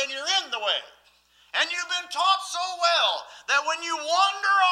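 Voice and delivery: loud, high, fervent preaching; full-force preaching. Acted.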